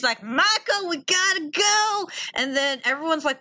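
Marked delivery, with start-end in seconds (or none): 0.2-2.1 high, drawn-out urgent cry; imitated frantic urgency; mock panic. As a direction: stretch the words in hammed-up alarm. Imitates April reacting to vet's news.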